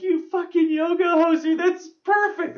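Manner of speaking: very high, excited, laughing voice; delighted squeal. Retold or imitated. Kevin losing it at the joke, high delighted squeal. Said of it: "And he's like".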